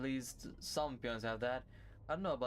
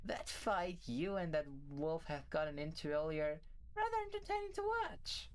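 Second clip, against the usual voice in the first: Oriole. Acted. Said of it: "You seem to be in a chipper mood".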